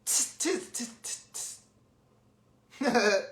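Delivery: soft voiceless "tss" repeated in little bursts; monkey chatter. A narrator's reading. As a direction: imitate monkeys with quick hissy tuts. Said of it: "at him and said".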